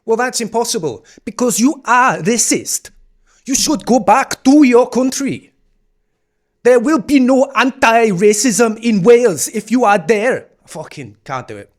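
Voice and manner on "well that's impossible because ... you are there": loud, high mocking Welsh African accent. Mocking a clip's speaker in a Welsh African accent. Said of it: "that was almost a Welsh African accent".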